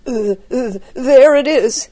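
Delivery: put-on spooked boy crying out; startled gasping cry. A narrator's reading. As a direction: gasp and stutter, then cry out in alarm. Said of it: "Suddenly Jack gave a gasp".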